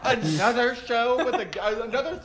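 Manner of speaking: high, laughing mock sneer; dismissive gatekeepers. Mocking publishers, high laughing sneer. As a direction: sneer like a bored executive. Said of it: "they're like".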